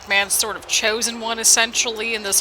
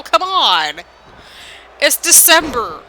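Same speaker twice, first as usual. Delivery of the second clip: higher and louder, startled outrage at the noise; real exasperated shout; 4 semitones higher, 6 dB louder, slower than usual. Real, not acted. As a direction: yell at the neighbours' fireworks. Fireworks set off outside.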